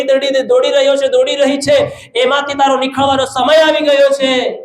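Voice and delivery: high, sustained proclamation; forceful preaching. Real, not acted. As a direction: declare each promise like a preacher at full pitch. Proclaims promises to congregation.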